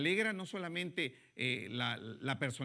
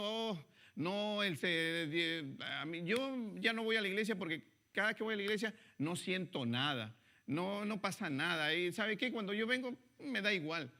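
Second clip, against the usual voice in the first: higher-pitched mock complaint, a little pinched; whiny churchgoer; 6 semitones higher than usual. Preacher mimics a churchgoer who feels nothing, mock whining. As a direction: imitate the bored believer griping about church.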